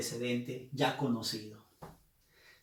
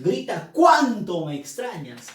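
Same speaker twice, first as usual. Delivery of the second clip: "cuánto" punched louder as an exclamation; emphatic cuánto; 3 semitones higher, 7 dB louder than usual. Shown as a demonstration. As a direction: stress "cuánto" with admiration. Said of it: "Con énfasis". ("cuánto" enfático y más fuerte)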